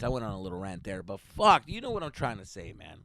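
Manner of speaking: normal talking voice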